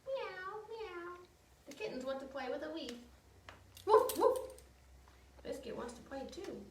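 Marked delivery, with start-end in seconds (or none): 0.1-1.2 high little mewing meow; high kitten meow. Acted. Cat sound in picture book. 3.9-4.4 high, loud cheerful woof; playful dog bark. Acted. Dog sound in picture book.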